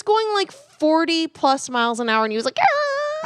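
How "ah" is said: very high screeching-brakes noise; high mock tire squeal; imitated screech. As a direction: imitate a car screeching to a halt. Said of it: "Screeching to".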